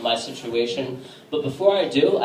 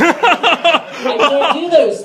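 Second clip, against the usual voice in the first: high, slow, laughing through it; 14 semitones higher, 8 dB louder, much slower than usual. Real, not acted. Comic laughing through his punchline to the crowd. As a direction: crack up on the punchline.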